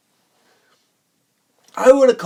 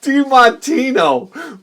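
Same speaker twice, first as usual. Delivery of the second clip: mocking repeat; 8 semitones higher, 3 dB louder, much slower than usual. Mocking the made-up name.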